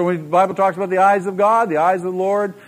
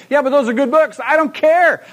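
high, louder put-on voice of a dismissive listener; mock objector; 9 semitones higher, 4 dB louder than usual. Preacher voicing a churchgoer who shrugs off the question, mock dismissive. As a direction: brush it off like a defensive listener.

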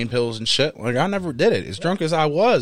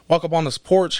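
ordinary delivery

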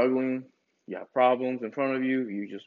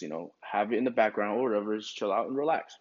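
ordinary talk

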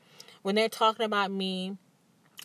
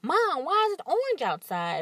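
put-on a light childlike voice, higher; child's question; 8 semitones higher than usual. Son asking his mom about the orange sky, light childlike voice. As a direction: ask it like a curious little boy. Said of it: "he's like".

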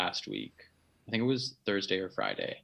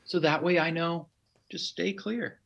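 higher, lightly voiced wife; husband mimicking his wife explaining herself; wife's voice; 7 semitones higher, slower than usual. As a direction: imitate the wife, higher and sweet. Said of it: "she was like".

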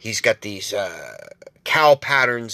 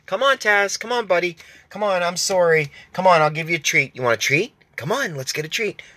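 high, sing-song coaxing call; coaxing the dog; 4 semitones higher than usual. Retold or imitated. Stepdad coaxing his dog out from under the deck, high and pleading. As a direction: sweet-talk a scared dog out from hiding.

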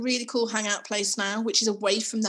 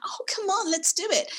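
eager; 12 semitones higher than usual. Retold or imitated.

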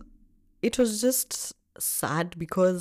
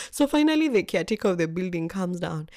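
ordinary talk, nothing marked

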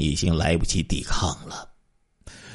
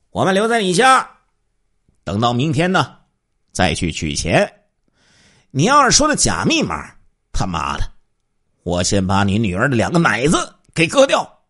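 intimidating threat; 5 semitones higher, 4 dB louder than usual. Acted. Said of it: "又恐吓说道".

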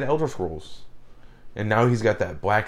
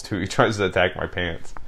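usual speaking voice

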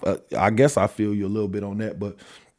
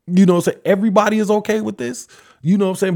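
raised outrage; 8 semitones higher, 4 dB louder, faster than usual. Real, not acted. Outraged at everyone approving.

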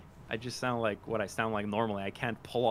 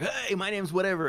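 higher-pitched imitation of an eager introduction; 8 semitones higher, 4 dB louder than usual. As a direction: imitate a keen creator, pitched up. Voicing an eager TikTok cartoonist.

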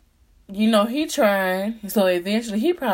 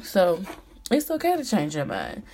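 ordinary delivery

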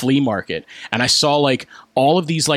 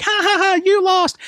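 put-on very high sing-out child's jeer; mock a loser like a gloating kid; taunting little girl. Little girl taunting the losing wrestler, high mocking jeer.

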